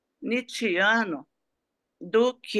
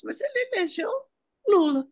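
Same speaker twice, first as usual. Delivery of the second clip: emphatic; 12 semitones higher than usual. Real, not acted. Emphatic: Brazil elected Lula.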